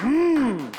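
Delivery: loud, high, falling "mm"; hammed-up hum over the wine; gossipy aunt's hum. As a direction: savor a big mock "mmm". Acting out gossiping aunt drinking wine.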